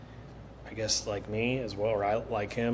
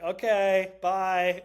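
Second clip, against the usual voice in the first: dismissive brush-off; 9 semitones higher, 6 dB louder, much slower than usual. Retold or imitated. Brushing off dad.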